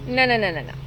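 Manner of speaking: Stumpy correcting the bat; firm no. Acted. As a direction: shut it down gently but firmly. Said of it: "said Stumpy".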